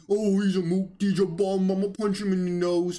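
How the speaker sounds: lightly voiced blustering tough guy; a hint of mock bravado. Host mimicking what people would say to Trump, mock tough guy. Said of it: "it's a tough guy act".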